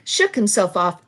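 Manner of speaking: ordinary delivery, nothing marked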